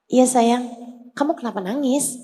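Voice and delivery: put-on soft, gentle question to a crying child. Prophet gently asking the crying girl, soft and kind. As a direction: ask kindly, softly.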